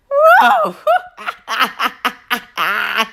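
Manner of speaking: high, rapid burst of laughter; laughing. Real, not acted. Reviewer amused by his own ghost scene, laughing. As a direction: crack up helplessly.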